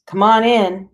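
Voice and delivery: loud invitation to someone outside; calling out. A narrator's reading. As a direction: call through a closed door. Calling to knock at the door.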